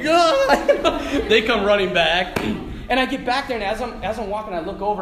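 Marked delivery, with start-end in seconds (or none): 0.1-1.2 high, loud mock scream; hammed-up stampede cry; mob's yell. Priest imitating the mob rushing back, high yell. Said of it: "the mob just comes flying like".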